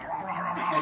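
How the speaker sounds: mock gobbling growl; ravenous eating noise. Poor kid wolfing dinner at friend's house, ravenous gobbling noise. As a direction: imitate wolfing down food noisily.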